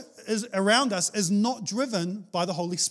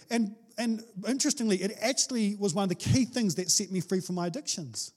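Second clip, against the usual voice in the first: normal talking voice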